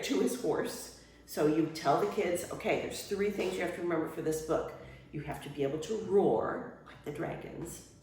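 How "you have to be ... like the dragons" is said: ordinary talk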